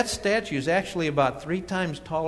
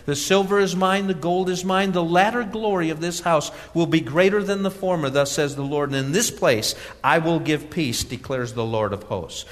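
reads God's words louder and higher, preaching in full force; preaching scripture; 3 semitones higher, 4 dB louder than usual. Acted. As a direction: proclaim it like the Lord speaking to His people.

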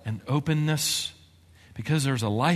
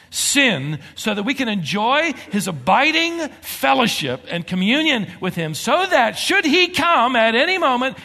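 higher and louder rising sermon climax; preaching at full force; 9 semitones higher, 7 dB louder than usual. Acted. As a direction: preach it out, climbing with urgency.

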